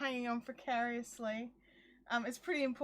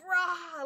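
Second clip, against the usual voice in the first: short frustrated roar, louder; mock angry growl; 3 semitones higher, 4 dB louder than usual. Losing her cool at the muddy dog, a hammed-up growl. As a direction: let out a cross rah. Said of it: "I've lost my cool".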